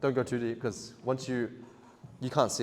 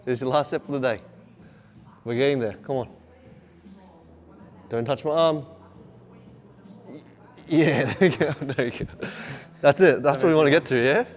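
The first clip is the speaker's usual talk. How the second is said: loud cheering with a laugh; shouting encouragement; 6 semitones higher, 8 dB louder than usual. Real, not acted. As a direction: hype the last set home. Coach cheering client, laughing.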